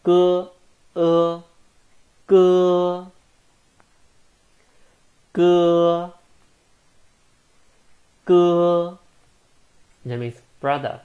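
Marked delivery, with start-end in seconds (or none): 0.0-9.0 歌 held high, level and loud; loud, level high-pitched 哥; first tone. Shown as a demonstration. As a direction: keep 哥 high and flat, first tone. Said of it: "first tone".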